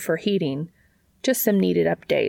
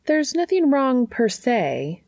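higher, slower, hesitant realtor; 7 semitones higher, 3 dB louder, slower than usual. A narrator's reading. Realtor answering Dean's question, higher and careful. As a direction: lift the voice for the reluctant realtor.

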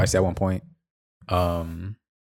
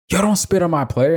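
higher-pitched mock yell; retold yell; 8 semitones higher than usual. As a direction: imitate someone calling out a warning. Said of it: "somebody yell out".

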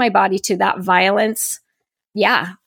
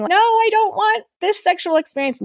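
much higher, small pleading voice of the body; a meek, higher inner voice refusing; body's small voice; 11 semitones higher than usual. Retold or imitated.

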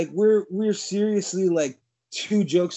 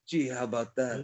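calm, soft mock Dredd; 5 semitones lower, 7 dB quieter than usual.

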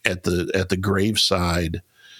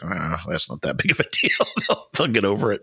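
lightly mocked brush-off with a chuckle; dismissive parent; faster than usual. Parent brushing off kids' troubles, lightly dismissive. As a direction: wave it away like an unbothered parent, half laughing.

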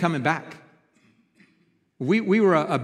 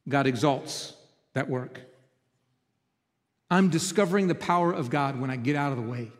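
ordinary talk

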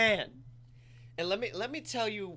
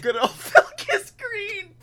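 high, loud name broken by laughter; laughing; 13 semitones higher, 7 dB louder, slower than usual. Real, not acted. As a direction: laugh it out slowly.

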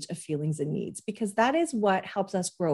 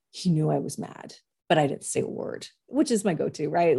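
usual speaking voice

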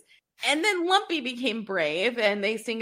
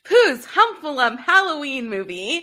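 brighter, louder, laughing through it; cheerful, louder restart with a laugh; louder upbeat retake; 6 semitones higher, 7 dB louder than usual. Pretend.

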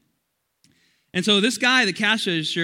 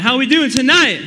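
hyped-up louder greeting; 8 semitones higher, 11 dB louder than usual. Real, not acted. Pastor calling out to students for a louder response, loud and high. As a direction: call it out high and loud to the crowd. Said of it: "I think we can do better".

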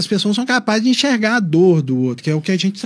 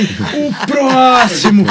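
very slow, louder, bellowed call for the next patient; Bruna calling next; 3 semitones higher, 6 dB louder, much slower than usual. Retold or imitated. Mimicking a colleague summoning patients, slow louder drawn-out call. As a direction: drag the word out as a bored hallway call. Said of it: "E eu tava igual a Bruna Sufichinha".

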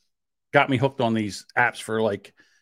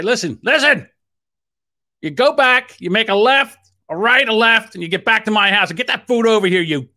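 higher, pushy step-by-step directions; bossy orders; 7 semitones higher, 3 dB louder than usual. Retold or imitated. Customer telling the lost driver the way, bossy and raised. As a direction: order him back like a traffic cop.

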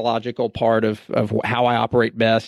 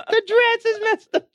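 put-on much higher, wailing little girl; child losing it. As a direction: cry it out like a kid whose doll is ruined. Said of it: "my daughter just loses it".

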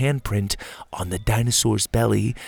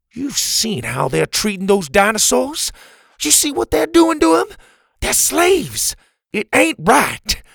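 much higher, loud, furious; angry outburst; 14 semitones higher, 5 dB louder than usual. Acted. Pa furiously defends himself to Bo, shouting. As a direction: shout back in outrage. Pa erupts, pointing at Bo.

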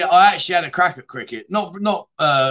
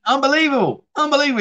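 amazed disbelief; 8 semitones higher, faster than usual. Real, not acted. Proud dad hearing son's news.

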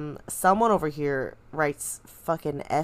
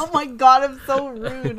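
mock sob; 6 semitones higher than usual. Girlfriend mock-wails at her own rudeness, hammed-up sob. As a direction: ham up a tearful apology.

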